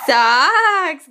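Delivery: loud laughing cheer. Real, not acted. Mother cheering with a laugh when Gavin signs socks. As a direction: burst out laughing with pride.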